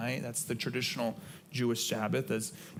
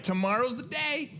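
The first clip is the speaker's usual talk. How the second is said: much higher, eager and thrilled; excited; 13 semitones higher, 3 dB louder than usual. Retold or imitated. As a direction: squeal it like a kid before a holiday. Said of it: "I'm like".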